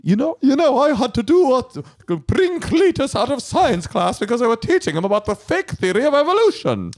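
very high-pitched cartoonish right-winger; right-winger character. Playing right-wing relative for wife, shrieky-high character voice. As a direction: squeak out the complaint in a put-on high voice. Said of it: "I'm the right winger".

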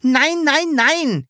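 high, loud triple protest; high-pitched insistent yell; shouting. A narrator's reading. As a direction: cry out in vehement denial. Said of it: "schrie das Sams".

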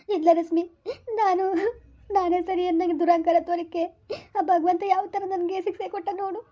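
grieving sister confessing her pride; tearful. Acted. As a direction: lament it through tears. Weeping sister blames her own pride.

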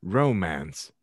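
plain reading voice

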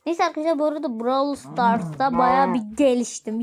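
normal talking voice

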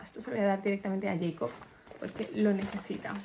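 ordinary talk

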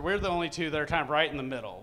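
normal talking voice

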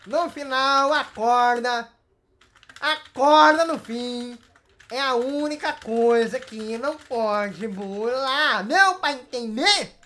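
slow, loud, word-by-word emphasis; emphatic rule-laying. Real, not acted. Host laying down the rope rule, slow and exasperated. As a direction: hammer the rule home, drawing out each word.

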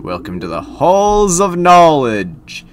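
slow, grand welcome swelling high; pompous host's high flourish; Sir Manuel. Acted. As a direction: stretch the title out ceremonially. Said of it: "he says it that way every single time".

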